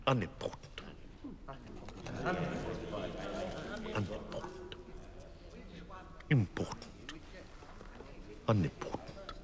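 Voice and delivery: low, soft undertone; quiet, absent-minded musing, pitched low; muttering to oneself. Acted. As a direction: try the words over under your breath. Weighing words to himself in court.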